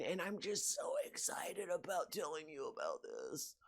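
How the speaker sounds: soft, soothing, breathy pastor voice; youth pastor voice. Retold or imitated. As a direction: sound gently excited like a youth pastor.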